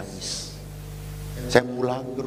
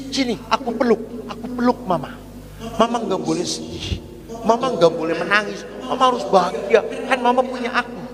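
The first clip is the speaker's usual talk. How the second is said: put-on high childlike pleading, higher than his own voice; a small boy begging his mother not to be sad; 10 semitones higher than usual. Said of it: "dia teriak". (suara anak kecil yang tinggi, membujuk mamanya)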